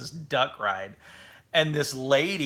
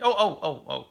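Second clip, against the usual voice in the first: high, quick string of startled ohs; mock alarm; 4 semitones higher than usual. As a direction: blurt it out like a driver caught drifting. Acting out a drifting driver's alarm.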